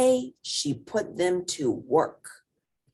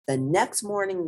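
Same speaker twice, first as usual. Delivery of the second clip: ordinary delivery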